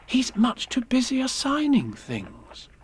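lightly acted, lifted storybook voice; lightly voiced Alice.

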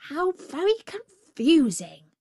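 bewildered storybook jackal; confused jackal. A narrator's reading.

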